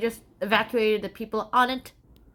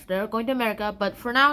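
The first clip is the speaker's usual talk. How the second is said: ordinary talk, nothing marked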